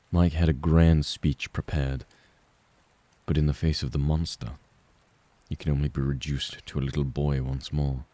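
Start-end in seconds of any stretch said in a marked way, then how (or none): none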